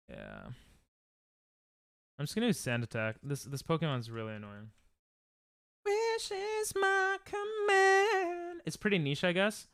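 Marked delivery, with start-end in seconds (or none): 5.9-8.6 very high, drawn-out mock voice; put-on voice. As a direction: stretch it out slowly on one high held pitch. Puts on a voice mid-game.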